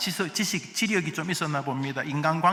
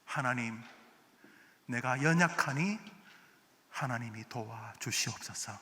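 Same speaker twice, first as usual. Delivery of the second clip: softer, lower pleading; humble prayer; 6 semitones lower, 7 dB quieter than usual. Retold or imitated. Wise believer praying to God, soft humble plea. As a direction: plead quietly before God. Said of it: "이렇게 시작합니다".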